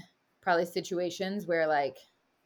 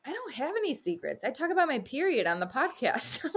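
breezy mock matter-of-fact; 7 semitones higher than usual. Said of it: "I looked at him and I'm like".

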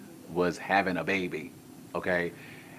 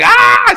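panicked scream; 23 dB louder than usual. Retold or imitated. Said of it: "So we were screaming".

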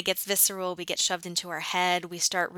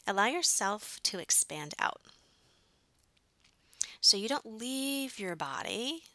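guided meditation. Acted. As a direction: guide them gently into breath.

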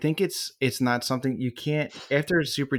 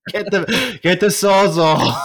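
laughing; 10 semitones higher, 7 dB louder, slower than usual. Real, not acted. Joking over the drilling noise.

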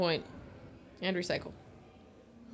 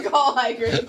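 laughing; 3 semitones higher, slower than usual. Real, not acted. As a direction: laugh out the question. Cohosts crack up.

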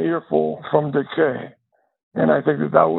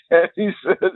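laughing; 4 semitones higher than usual. Real, not acted. Laughing while resuming story.

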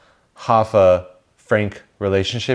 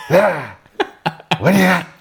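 imitating Pacino; 8 semitones higher than usual. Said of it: "he went full Pacino".

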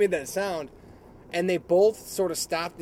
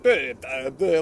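mocking their tone; 3 semitones lower, 6 dB louder, slower than usual. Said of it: "their tones were so".

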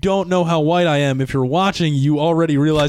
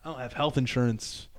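softer, lower confiding to the bartender; hushed aside; 5 semitones lower, 9 dB quieter than usual. Retold or imitated. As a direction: lean in and keep it low. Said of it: "I lean into the bartender".

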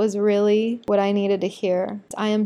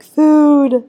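loud, higher, hungry cry of food; excited yell; 6 semitones higher, 11 dB louder than usual. Retold or imitated. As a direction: shout for the food with glee. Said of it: "I was the half of the girls that was like".

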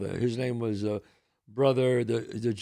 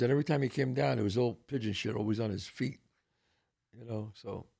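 ordinary delivery

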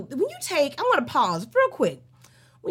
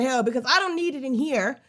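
normal talking voice

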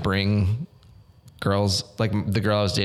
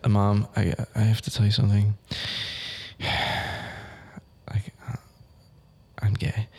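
hesitant, hushed confession with a heavy sigh; earnest fake coming-out, trailing to near whisper; dead serious. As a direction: act out a hard confession, halting before the reveal. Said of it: "Real serious".

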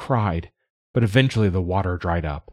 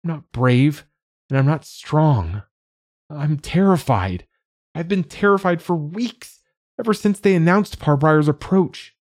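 high, mounting distress; tearful, terrified; 8 semitones higher, 4 dB louder than usual. A narrator's reading. Child admits weeks of terror to dad, tearful and terrified. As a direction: confess the fear, climbing higher in pitch. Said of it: "could feel the tears surging again".

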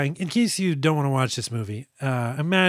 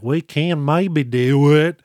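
bad Southern accent; 2 semitones higher, 6 dB louder, much slower than usual. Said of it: "try a Southern hillbilly accent".

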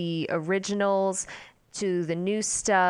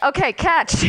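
high, loud comic call; hammed-up shout to someone catching; mock calling out; 9 semitones higher, 8 dB louder, slower than usual. Acting out tossing the baby to Owen, mock calling out.

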